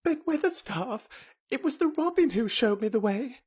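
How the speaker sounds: put-on high girl's voice calling up; breathless, high-pitched explanation; gasping call. A narrator's reading. Mary calling up to Ben, gasping. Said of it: "called up to him with a sort of gasp".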